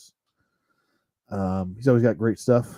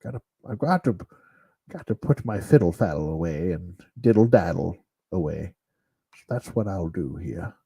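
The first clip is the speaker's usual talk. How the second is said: put-on old man Sean Connery voice; 5 semitones lower than usual. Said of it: "old man Sean Connery awkward voice".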